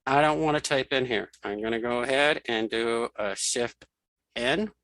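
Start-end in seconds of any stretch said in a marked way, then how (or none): none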